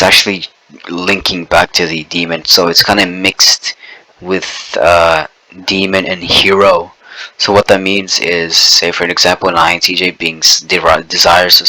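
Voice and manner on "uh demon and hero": ordinary talk, nothing marked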